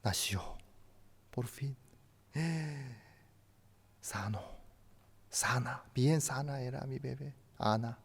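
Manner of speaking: whispered in awe; hushed whisper. Real, not acted. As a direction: breathe it softly, moved. Tender memory of newborn daughter.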